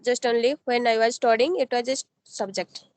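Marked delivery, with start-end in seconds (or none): none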